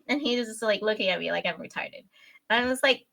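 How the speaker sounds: ordinary talk, nothing marked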